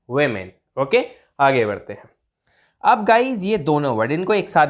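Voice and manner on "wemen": women as "we-men", falling; plural women pronunciation. Shown as a demonstration. Teacher modelling plural for learners, "we-men" pronunciation. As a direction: unround the first vowel of women to "we". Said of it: "like that".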